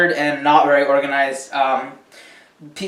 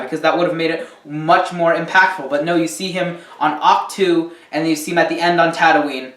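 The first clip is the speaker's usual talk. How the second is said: normal talking voice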